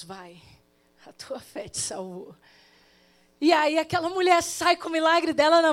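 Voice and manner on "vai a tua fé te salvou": soft, low and tender, almost hushed; Jesus tenderly releasing the healed woman; gentle Jesus. Acted. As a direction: speak it like a kind blessing.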